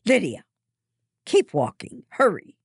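high, loud hurried order; stern call. A narrator's reading. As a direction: hurry her along firmly. Adult hurries girl along.

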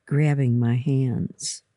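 ordinary delivery

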